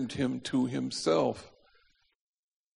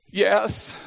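terrified; 7 semitones higher, 6 dB louder than usual. Retold or imitated. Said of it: "Mario, terrified".

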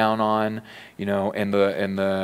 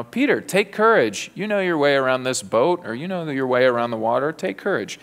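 high, chummy hammed-up pep talk to Peter; mock pep talk; 4 semitones higher than usual. Preacher mocks a chummy pep talk to Peter, high and sing-song. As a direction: slap Peter on the back, sing-song and breezy.

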